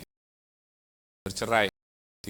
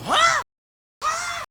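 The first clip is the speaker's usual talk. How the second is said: very high, shrill indignant huh; outraged huh. Retold or imitated. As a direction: shriek it in angry disbelief. Istri mengamuk tidak terima. (jeritan kaget marah)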